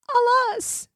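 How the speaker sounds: high anguished cry of alas; queen crying out. A narrator's reading. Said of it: "cried the queen".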